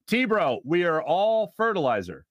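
ordinary delivery

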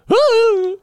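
high, loud cartoon whoop; cartoon woo. Host imitating a cartoon old woman falling over. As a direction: yelp it like a cartoon as you fall over.

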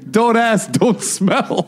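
cheeky sing-song slogan; mock slogan. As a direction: chant the pun. Fart joke slogan.

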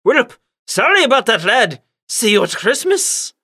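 chiming in, high British uncle; hammed-up high British voice; Uncle Penny Codsworth Manshire. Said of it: "Uncle Penny Codsworth Manshire chimed in".

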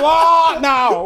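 loud, high defiant shout; full-voiced high taunt. Real, not acted. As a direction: yell it as a challenge. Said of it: "It's time to shout".

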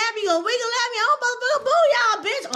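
very high, mocking taunt while slapping a rear; character's crude taunt. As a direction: taunt them as the unhinged character, high and wild. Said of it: "he wrote, tapping on the ass".

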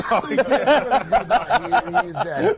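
real laughter at his friend's plunge; laughing. Real, not acted. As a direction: gasp it out while cracking up.

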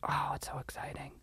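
breathless hushed whisper of excitement; whispered playoff excitement; excited whisper. Pretend. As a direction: whisper it like a thrilling secret. Excited hushed aside about playoffs.

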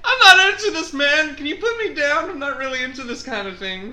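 very high, loud awkward protest, settling down; mock squeamish protest. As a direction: protest politely while being strangled. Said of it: "I was just like".

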